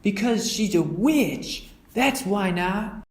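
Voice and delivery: high, slow, certain cub; Brother. Acted. Brother explaining to mother why they avoid the witch, certain. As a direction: insist with a hint of spookiness.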